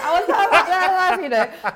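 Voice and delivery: high squealing laugh through words. Real, not acted. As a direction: laugh it out, giggling. Laughing admitting she wasn't tough.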